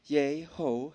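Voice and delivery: "Yehovah" very slowly as yei-ho-hei-vei; breathy syllabic pronunciation. Shown as a demonstration. Preacher modelling for the congregation, "Yehovah" as slow yei-ho-hei-vei. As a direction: stretch each syllable of "Yehovah" on an audible breath. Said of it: "Hasta la pronunciación tiene sentido".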